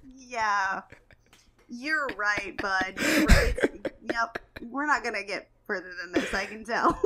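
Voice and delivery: loud, laughing you're right bud; humoring a stubborn commenter through laughter; laughing mock agreement. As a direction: agree sarcastically while cracking up. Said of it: "I'm like".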